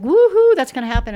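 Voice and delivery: high, loud whoop of mock delight; excited cheer. Commentator recalling how she once cheered impeachment news, hammed-up whoop. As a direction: whoop like the news just made your day. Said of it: "I used to get excited".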